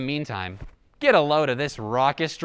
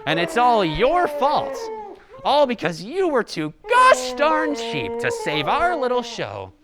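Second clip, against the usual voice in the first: angry shouting; 7 semitones higher, 6 dB louder than usual. Acted. Threatening hostages, shouting.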